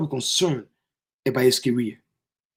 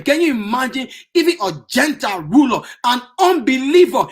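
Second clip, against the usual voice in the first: ordinary talk, nothing marked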